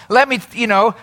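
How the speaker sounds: normal talking voice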